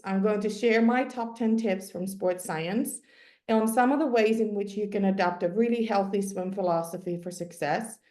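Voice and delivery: ordinary talk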